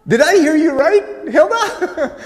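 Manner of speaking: very high, loud incredulous question; loud disbelief. Retold or imitated. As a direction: squawk it in comic astonishment. Pastor retells his baffled reaction.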